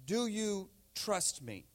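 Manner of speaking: slow, measured divine question; God. Retold or imitated. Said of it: "And God says".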